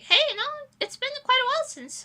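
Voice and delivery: ordinary delivery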